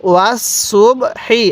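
slow, syllable-by-syllable ওয়াস সুব হি; model recitation. Shown as a demonstration. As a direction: say ওয়াস সুব হি slowly, each syllable separate. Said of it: "কীভাবে শিখব?".

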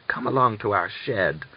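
ordinary delivery

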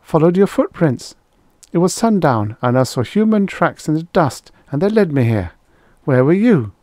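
put-on high, eager girl's voice; talkative girl. A narrator's reading. Girl explains herself, voiced by narrator.

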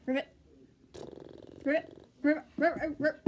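childish mock frog ribbits; frog croaking. Magic pony turned frog, croaking playful ribbits. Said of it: "she makes a different animal sound".

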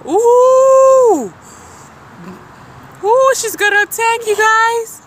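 high, loud excited warning. Real, not acted. Warning the viewers she will attack, high and loud. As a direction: alarm the viewers.

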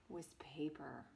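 whispered reveal of the name; soft, breathless whisper; dramatic whisper. A narrator's reading. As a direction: hush it like a secret.

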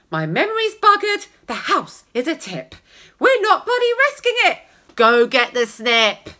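high, loud fed-up rant; hammed-up exasperated mum; exasperated middle-aged woman. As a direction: shout it up high, at wits' end. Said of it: "The middle-aged lady cried".

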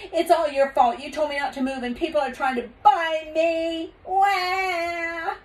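high whine building to a wail; whining child. A narrator's reading. Taya blames her father, whining into a wail. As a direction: whine it high, then bawl.

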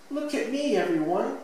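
put-on high child's call for attention; showing off. A narrator's reading. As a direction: call out proudly like a little kid at play.

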